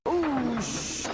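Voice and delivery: hushing. A narrator's reading. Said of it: "cried Thomas".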